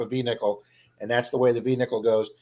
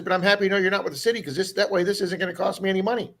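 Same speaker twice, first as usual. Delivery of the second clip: lightly imitated lady, pitched up and quick to start; higher retold voice, rattling off the relief; lady's relief; 6 semitones higher, faster than usual. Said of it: "And she goes".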